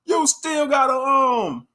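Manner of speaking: high, loud scolding yell; angry callout. Pretend. Imagined heckler yelling at the leader to sit down, loud angry callout. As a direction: tell him off, full volume. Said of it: "I'd be like".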